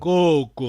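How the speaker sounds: Koko with a long first o; teacher stretching the first syllable of Koko; long vowel. Shown as a demonstration. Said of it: "Aquele coco é vogal longa".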